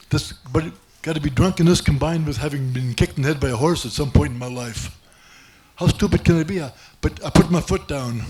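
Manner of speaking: normal talking voice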